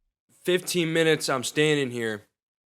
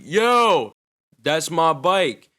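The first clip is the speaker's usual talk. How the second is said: loud, high call, slowing on that's my bike; yelling across the street; 5 semitones higher, 6 dB louder, much slower than usual. Retold or imitated. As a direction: shout it across a busy road to the cop. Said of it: "I yell across the street".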